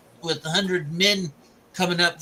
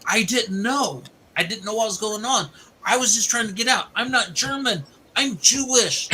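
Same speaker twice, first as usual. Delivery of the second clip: higher-pitched acted protest; retold pleading; 7 semitones higher than usual. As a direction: plead innocence, pitch climbing toward the end. Retold prisoner pleads his innocence.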